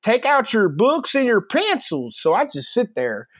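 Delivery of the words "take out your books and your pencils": high-pitched mock Southern teacher; imitated English teacher. Teacher orders the class to start, imitated high-pitched. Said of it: "and the teacher says".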